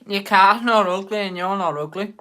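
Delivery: almost shouting "not ugly" at the chat; loud protest. Real, not acted. Protesting loudly to a viewer.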